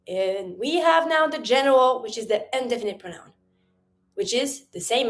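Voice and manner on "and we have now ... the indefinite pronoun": usual speaking voice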